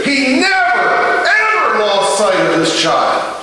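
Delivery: climbing into a shouted high peak, slow and hammered; preaching at full force. Acted. Pastor to congregation, driving it home in a full preaching shout. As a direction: preach it out to the crowd, building to a roar. Sermon climax, preaching hard.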